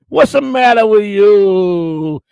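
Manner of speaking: slow, loud, hammed-up mafia boss scolding; hammed-up mob boss. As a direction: bark it out slowly like a mock Don. Godfather-style chat quote.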